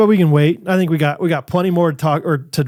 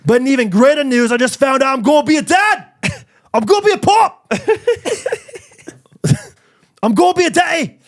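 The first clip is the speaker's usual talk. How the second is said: put-on much higher, excited Australian-London accent; hammed-up Australian accent; 7 semitones higher than usual. Podcaster reading fan's baby news in hammed-up Australian accent. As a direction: announce it in bad Australian, breaking into laughter. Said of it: "feel like I'm talking like John Tyson".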